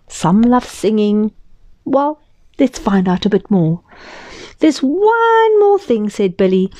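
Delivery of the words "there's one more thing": put-on high, slow little boy voice; slow high child's voice. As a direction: play Billy stretching it out eagerly. Billy's eager question.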